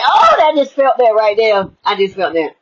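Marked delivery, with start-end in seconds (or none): 0.0-0.4 mock joyful gasp. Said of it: "that thing when she said".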